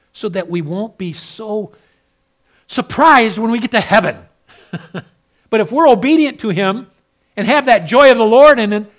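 loud, high, fiery pulpit preaching; full-force preaching. Acted. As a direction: preach it out hard to the congregation. Preaching again.

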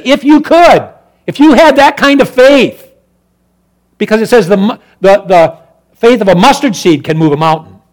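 loud, high preacher's cry; loud gospel preaching; fiery preaching. Acted. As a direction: proclaim the commands to the congregation. Preaching climax.

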